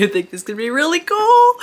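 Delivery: high, loud and laughing; giddy laugh through the line. Real, not acted. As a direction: laugh it out in delight. Laughing over the plot twist.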